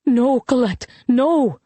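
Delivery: understated cry of warning; mother's cry. A narrator's reading. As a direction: cry out to stop your daughter, contained. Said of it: "her mother cried".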